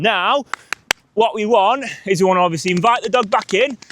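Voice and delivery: ordinary talk, nothing marked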